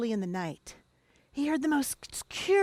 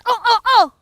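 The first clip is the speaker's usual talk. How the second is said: loud high call; calling the dogs; 10 semitones higher, 14 dB louder, faster than usual. Acted. Frightened man hollering for his dogs, loud high call.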